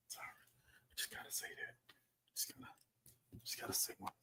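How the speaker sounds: hushed confession under the breath; whispered aside. Real, not acted. Reviewer whispering an apology for criticizing Tyler Perry. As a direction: whisper it like a guilty secret.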